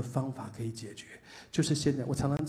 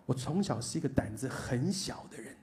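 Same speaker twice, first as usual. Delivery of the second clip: normal talking voice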